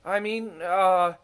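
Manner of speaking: high, loud flustered cover-up; feigned composure. A narrator's reading. Smuggins covers his surprise before Misha, flustered. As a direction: bluff it out too brightly. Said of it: "tried to recover himself".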